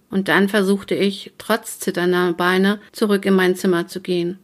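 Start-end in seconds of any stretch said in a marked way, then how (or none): none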